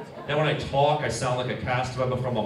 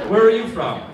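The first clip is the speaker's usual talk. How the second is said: calling out across the room; loud call-out; 5 semitones higher, 6 dB louder than usual. Real, not acted. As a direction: raise your voice to ask. Calling out to audience member.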